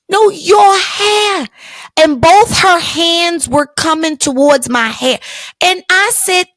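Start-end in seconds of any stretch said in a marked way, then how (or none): 0.1-1.5 insistent. Retold or imitated. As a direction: mimic a tipsy stranger pushing. Drunk woman insisting.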